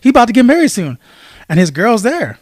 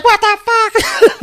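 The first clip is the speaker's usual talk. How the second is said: slow, much higher, laughed through; laughing mock outrage; 3 dB louder, much slower than usual. Storyteller voicing the punched girl, laughing and much higher. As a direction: play the stunned girl while cracking up. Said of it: "she's like".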